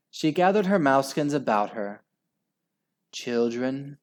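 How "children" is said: ordinary delivery, nothing marked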